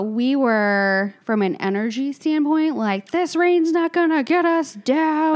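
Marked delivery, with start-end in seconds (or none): 3.1-5.4 higher-pitched mock rallying cry; rally the group against the rain, lightly hammed-up; hyped-up group voice. Friends fired up for a game night, hyped-up.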